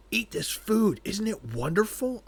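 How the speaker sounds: overjoyed host voice; gushing offer. Retold or imitated. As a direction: push the food on her with glee. Townsfolk feeding her, overjoyed.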